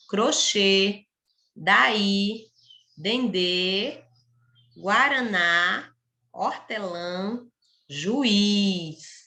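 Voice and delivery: measured word list from "Ali" to "juiz", each final syllable held; stress on last syllable. Shown as a demonstration. Teacher giving oxytone examples "Ali" to "juiz", last syllable stressed and held. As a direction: lean on the ending of each word, "Ali" through "juiz", pausing between them. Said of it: "quando a última sílaba da palavra é a sílaba tônica".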